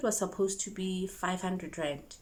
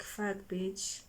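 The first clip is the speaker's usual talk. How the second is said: a much softer aside, under the breath; under-the-breath curse; 8 dB quieter than usual. Retold or imitated. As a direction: curse her quietly to yourself. Reaction to two-hour pin.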